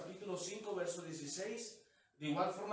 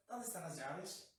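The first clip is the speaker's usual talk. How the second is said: softly, in no hurry; easygoing, softened mock question; mock leisurely search; 6 dB quieter than usual. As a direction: act out a relaxed, casual search. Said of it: "Así a gusto, caminando".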